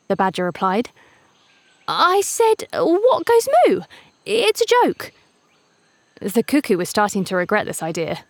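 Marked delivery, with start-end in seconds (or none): 1.9-2.7 much higher, chirpy bird voice; bright little bird calling out; eager cuckoo voice. A narrator's reading. Cuckoo repeats joke. 2.7-3.8 much higher, slow joke telling; high bird voice spelling out the joke; eager cuckoo voice. A narrator's reading. 4.2-5.1 much higher, chirpy bird voice; bright little bird calling out; eager cuckoo voice. A narrator's reading.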